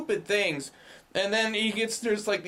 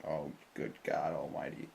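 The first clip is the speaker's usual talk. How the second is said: much lower, much softer muttered exasperation; exasperated groan; 11 semitones lower, 10 dB quieter than usual. Retold or imitated. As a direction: sink into a low, quiet, weary disbelief. Exasperated reaction to silly scene.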